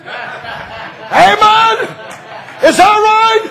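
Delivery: high, shouted revival preaching; preaching at full force. Acted. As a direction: cry it out to the congregation.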